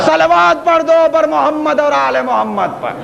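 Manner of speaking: high, ringing call to the congregation; full-force preaching. Real, not acted. Preacher rallies the crowd. (بلند آواز میں پُرجوش خطابت)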